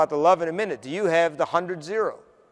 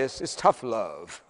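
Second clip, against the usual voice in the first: slow Southern drawl; 7 semitones lower, 7 dB quieter, slower than usual. Retold or imitated. CEO's punchline in Southern drawl.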